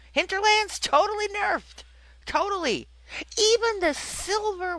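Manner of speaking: high-pitched, incredulous complaint; exasperated emphasis. Real, not acted. Podcaster warning listeners the Hinterlands got nerfed, exasperated.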